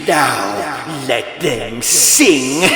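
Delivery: slow, menacing gloat; gloating monster. Acted. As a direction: savour each word like a satisfied beast. Monster gloating after the slaughter.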